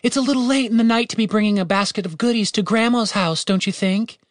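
high mocking call into the dark; sarcastic call across the clearing. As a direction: tease a stranger from afar. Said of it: "and called out".

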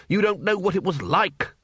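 ordinary delivery

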